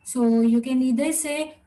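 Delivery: ordinary talk, nothing marked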